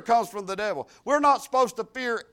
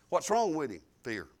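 ordinary talk

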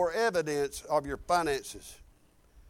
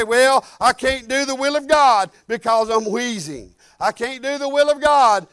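mock excuse; 3 semitones higher, 8 dB louder than usual. Acting out an excuse not to go.

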